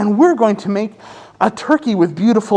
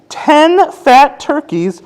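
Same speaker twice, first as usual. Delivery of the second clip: slow, lilting storybook counting; reading to children; 4 semitones higher, slower than usual. A narrator's reading. Teacher reading a counting book to kids, gentle.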